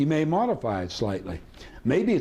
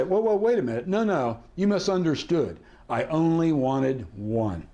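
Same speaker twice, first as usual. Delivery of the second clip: feigned misunderstanding; 2 semitones higher than usual. Shown as a demonstration. Halts the seller with feigned misunderstanding.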